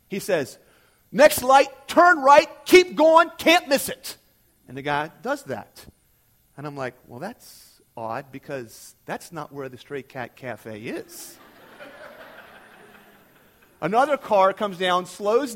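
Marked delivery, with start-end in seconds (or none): 1.1-4.2 higher, louder cheerful dismissal; loud brush-off. Retold or imitated. Trooper sending a lost driver away, loud breezy directions. 7.1-11.4 softer, slow bemused thought; puzzled aside. Retold or imitated. Young guardsman quietly puzzled by the trooper, soft slow aside. 11.8-12.4 soft laugh. Real, not acted. Laugh after story beat.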